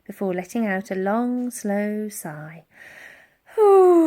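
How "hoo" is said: loud high mammoth hoot. A narrator's reading. Mammoth sighs, settles down.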